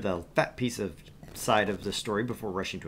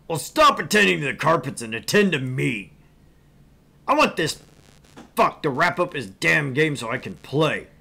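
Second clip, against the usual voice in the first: loud rude yelling; angry customer; 4 semitones higher, 8 dB louder than usual. Acted. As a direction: bark your demands.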